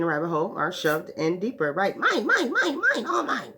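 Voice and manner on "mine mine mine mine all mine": high, quacky cartoon "Mine, mine" with a laugh; greedy cartoon duck claiming everything, high and laughing; Donald Duck. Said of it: "Like Donald Duck and Bugs Bunny".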